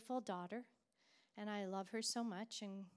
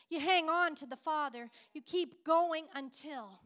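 loud, higher impassioned preaching; 4 semitones higher, 10 dB louder than usual. Acted. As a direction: preach it out with full force. Preaching at full force.

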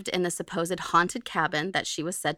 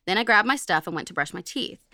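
normal talking voice

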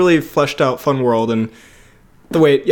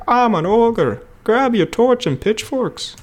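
a hint of a talking toy ogre, higher, slow on the second line; toy Shrek voice; 8 semitones higher, slower than usual. Recalling a childhood Shrek toy, lightly imitating its voice. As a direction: lightly mimic the toy's voice box ogre line. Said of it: "he was like".

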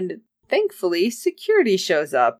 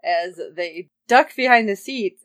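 ordinary talk, nothing marked

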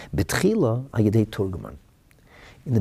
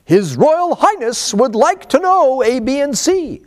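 high, loud, slow pompous announcement; formal court interpreter; 8 dB louder than usual. Retold or imitated. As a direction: announce it like a stiff royal herald. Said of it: "the interpreter would say".

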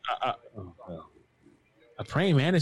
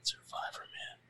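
dramatic whisper; 17 dB quieter than usual. Pretend. Whispered show title.

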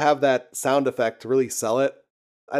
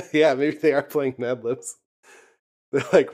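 ordinary talk, nothing marked

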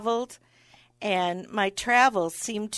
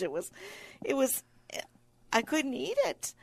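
ordinary delivery

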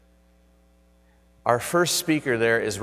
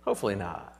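soft reassuring aside; 2 semitones lower, 6 dB quieter, much faster than usual. Shown as a demonstration. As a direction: murmur Hopefully not as a gentle settle. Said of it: "repeated, softer falling".

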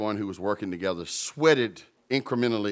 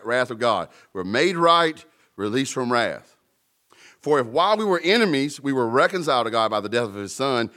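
louder, driving preacher's read; preaching scripture; 2 semitones higher, 6 dB louder than usual. Acted. Preacher reading Romans five aloud, preaching at full force. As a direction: preach it out to the room.

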